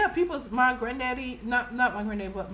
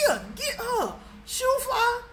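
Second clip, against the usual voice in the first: shooing her off; 13 semitones higher than usual. Retold or imitated. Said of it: "I'm like".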